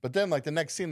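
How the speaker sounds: ordinary delivery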